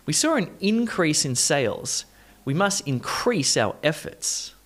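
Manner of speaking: IN-crease first, then in-CREASE; shifted stress. Shown as a demonstration. Teacher models number four, shifting stress. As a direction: move stress in "increase" from first syllable to second. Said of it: "doing some stress shifting first".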